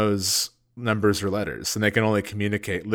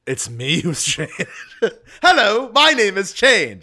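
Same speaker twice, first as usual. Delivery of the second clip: lightly hammed-up cartoon narrator; mock announcer voice; 9 semitones higher, 5 dB louder than usual. Joking persona introducing itself.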